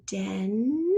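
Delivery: DEN stretched long and slow; sounded-out syllable. Shown as a demonstration. Teacher breaking a word into syllables for spelling, DEN drawn out slowly.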